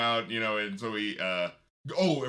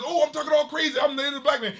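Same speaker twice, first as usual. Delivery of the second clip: much higher, louder mock crazy talk; over-the-top Sinbad; 11 semitones higher, 4 dB louder than usual. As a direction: parody of a comic trying too hard. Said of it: "it was that thing where it's like".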